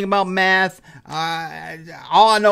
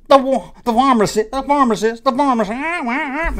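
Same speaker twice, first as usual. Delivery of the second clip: much higher, louder drawn-out toy announcer voice; bad impression; 8 semitones higher, 3 dB louder than usual. Admitting a bad impression of the toy's voice, higher and drawn out. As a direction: ham up the talking toy's voice, slowing at the end. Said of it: "I know it's not a good impression".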